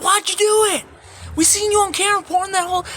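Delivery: high, loud, fast accusation; accusing guard. Retold or imitated. Jail staff grilling him over the camera footage, high loud and fast.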